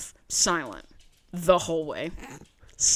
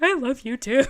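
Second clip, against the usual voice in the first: higher, hammed-up tearful answer; mock emotional reply; 5 semitones higher, 4 dB louder than usual. Daughter answering her emotional dad, retold in a high mock-weepy voice. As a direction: overplay the emotion, pitched up. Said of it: "started getting emotional".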